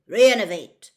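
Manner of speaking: loud, forceful incantation; spell-casting call. A narrator's reading. Mister Diggory revives Winky, loud incantation. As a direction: fire the word out as a spell.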